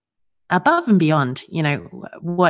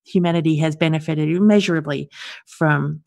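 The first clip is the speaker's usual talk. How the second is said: ordinary talk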